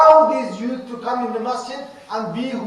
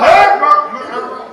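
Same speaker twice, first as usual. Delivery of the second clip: louder and higher, sharp; mock harsh call; 3 semitones higher, 8 dB louder than usual. Shown as a demonstration. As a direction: snap his name like a scolding. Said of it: "Look at that".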